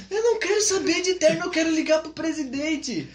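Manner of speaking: high, loud, laughing indignant cry; refuse, outraged at the question; indignant outburst. Retold or imitated. Missionary refusing to try on a suit, high and indignant.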